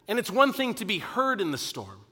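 usual speaking voice

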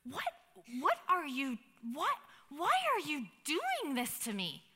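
stammering, incredulous plea with pitch leaping up; shocked disbelief. Acted. Edmond facing his friend's sword, shocked and betrayed. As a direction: stumble over the words, stunned and pleading.

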